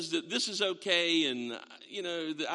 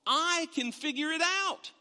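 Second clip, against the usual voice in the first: mock smug; 8 semitones higher than usual. Mocking self-reliant unbeliever.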